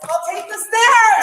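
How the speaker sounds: high, loud, drawn-out yell; shouted demand. Real, not acted. Detained woman insists on the stairs to officers, yelling. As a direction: yell it slowly at full pitch. Refusing the elevator under arrest.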